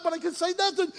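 usual speaking voice

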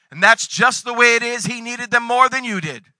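ordinary talk

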